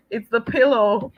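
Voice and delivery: words bubbling into giggles; laughing. Real, not acted. Host explaining the pillow to viewers, laughing. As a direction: explain it while cracking up. Laughing after being caught out.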